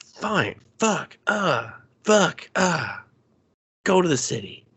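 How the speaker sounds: high-pitched, exasperated grumble; exasperated giving in. Retold or imitated. Amir reluctantly agrees to drive Rob, exasperated giving in. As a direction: give in grudgingly, imitating a fed-up driver. Driver grudgingly gives in, retold.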